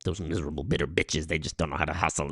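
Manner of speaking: low, hushed grumbling; mock contemptuous grumble. Mimicking a critic of bitter women, low grumble. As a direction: grumble it under the breath.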